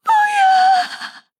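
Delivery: very high, loud, drawn-out shriek; terrified scream. Acted. Captive screams as the attackers hold on, piercing shriek. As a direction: scream the refusal in despair.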